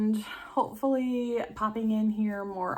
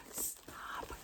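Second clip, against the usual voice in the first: whisper; 14 dB quieter than usual. Real, not acted. Whispered to herself.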